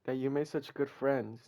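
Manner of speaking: ordinary talk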